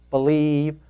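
normal talking voice

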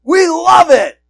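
slow mocking sarcasm, high and loud; sarcastic brothers. Brothers mocking Joseph's dream, loud sarcasm. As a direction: sneer it back at him. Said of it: "They're like".

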